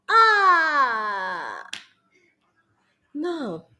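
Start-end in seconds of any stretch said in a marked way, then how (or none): 0.1-1.7 loud high ah cry; alarmed ah cry. Shown as a demonstration. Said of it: "they're causing me alarm".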